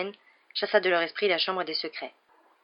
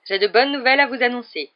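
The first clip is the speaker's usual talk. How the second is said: higher, louder cheerful news; bright announcement; 6 semitones higher, 8 dB louder than usual. A narrator's reading. Teacher bringing good news to the hall, cheerful. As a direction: announce it with pleasure.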